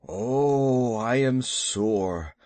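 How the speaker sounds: drawn-out, weary moan of soreness; aching groan. Acted. Battered Baloo complains of pain.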